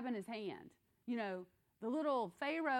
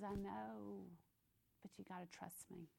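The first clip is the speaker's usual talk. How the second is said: gentle God; 11 dB quieter than usual. Pretend. God's gentle answer.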